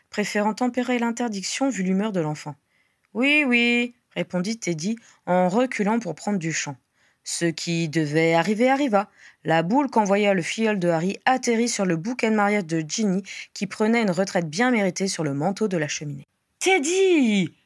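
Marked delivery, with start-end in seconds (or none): 3.1-3.9 put-on higher, drawn-out child's brush-off; dismissive yes. A narrator's reading. 16.6-17.5 high, loud scolding call of a name; stern protest. A narrator's reading. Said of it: "protesta Harry d'une voix sévère".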